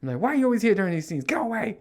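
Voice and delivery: high, loud exasperated yell; angry. Retold or imitated. Dad yelling at kids who keep showing up, angry. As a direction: shout them back to their room. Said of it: "I just got angry".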